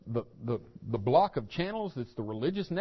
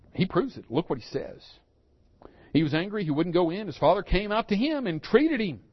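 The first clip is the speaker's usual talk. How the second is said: usual speaking voice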